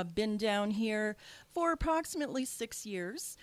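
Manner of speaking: ordinary talk, nothing marked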